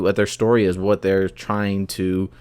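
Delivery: ordinary delivery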